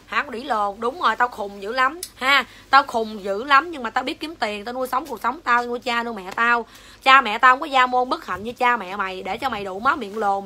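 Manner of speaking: ordinary talk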